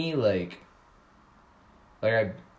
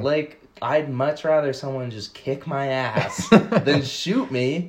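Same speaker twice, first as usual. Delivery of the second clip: laughing; 5 semitones higher, 7 dB louder, slower than usual. Real, not acted. Laughing while making his point.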